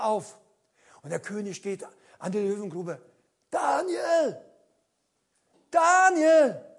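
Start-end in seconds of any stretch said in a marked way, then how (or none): 3.5-6.7 a king calling down into the pit, raised voice. Acted. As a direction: call his name into the dark pit, hoping. King calls into lion pit.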